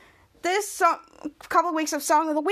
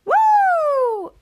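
very high loud cheer; excited whoop; 13 semitones higher, 6 dB louder than usual. Real, not acted. Host celebrating the end of the recap with a high loud whoop. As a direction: celebrate finishing the scene.

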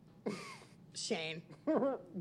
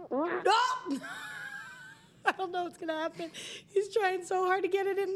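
laughing; 10 semitones higher than usual. Real, not acted. Watching friend stuff mouth.

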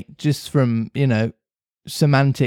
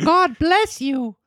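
Pinocchio; 14 semitones higher, slower than usual. Acted. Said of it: "said Pinocchio".

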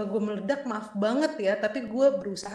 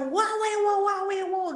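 angry outburst; 11 semitones higher, slower than usual. Retold or imitated.

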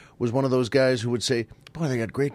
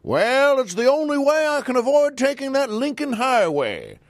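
Senator Claghorn; 9 semitones higher than usual. Retold or imitated. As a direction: boom it out like an old radio blowhard. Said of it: "the answer was something like".

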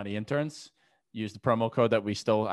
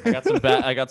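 suddenly loud and high on I got some bad; loud, pitched-up outburst; 4 semitones higher, 9 dB louder, faster than usual. Real, not acted. Co-host protesting the backlash, loud and high. Mock outrage, emphatic.

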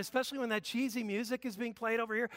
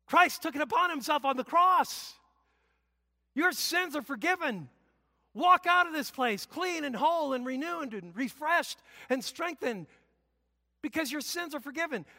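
raised, bright declaration easing off; proclaiming forgiveness; 5 semitones higher, 4 dB louder than usual. Shown as a demonstration. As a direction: announce the good news warmly. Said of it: "I come to you and I say".

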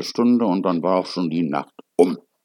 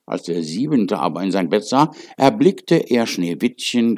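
ordinary delivery, nothing marked

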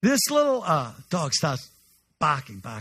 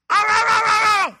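yelping puppy; 8 dB louder than usual. Retold or imitated. Puppy barking for food.

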